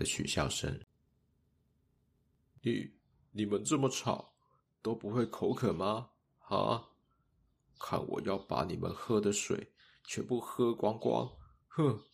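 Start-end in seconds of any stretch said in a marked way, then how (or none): 2.6-12.0 put-on higher-pitched, huffy child's threat; indignant scolding in a child's voice; angry outburst. A narrator's reading. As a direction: threaten the noisy classmates, ending in a huff. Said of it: "Jackie一气之下".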